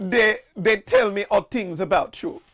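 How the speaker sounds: high-pitched mock-Jamaican fortune teller; hammed-up, wobbly Jamaican attempt; bad Jamaican accent. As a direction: tell a fortune in fake Jamaican. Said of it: "need to talk in a Jamaican accent".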